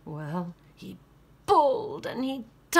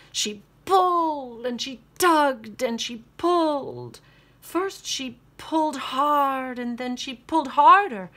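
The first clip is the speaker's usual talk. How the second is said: louder and higher with effort; straining build; 4 semitones higher, 6 dB louder than usual. A narrator's reading. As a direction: build the strain as she pulls.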